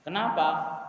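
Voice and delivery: ordinary talk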